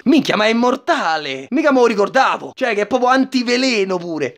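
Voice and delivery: ordinary talk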